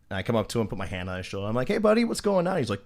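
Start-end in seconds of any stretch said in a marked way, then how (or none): 1.7-2.6 high, chummy greeting; overly friendly. Retold or imitated. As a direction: greet him as friendly as possible, pitched well up. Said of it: "fucking as friendly as possible".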